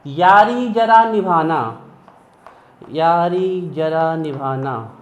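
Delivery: very slow, each word stretched; drawn-out mnemonic. Shown as a demonstration. Teacher introduces a trick for students to remember, slow drawn-out delivery. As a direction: declaim the memory phrase slowly for the class.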